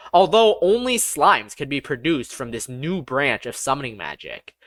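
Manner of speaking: plain reading voice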